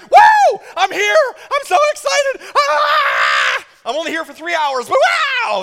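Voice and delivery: put-on high, loud squealing little kid; thrilled preschooler. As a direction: bounce with a kid's pure excitement. Preschooler voiced at attendance.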